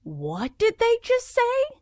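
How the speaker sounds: high, slow bewildered question; baffled by an accent you can't follow; confused. Retold or imitated. Mock-baffled listener hearing a strange accent. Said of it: "you're like".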